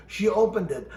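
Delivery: plain reading voice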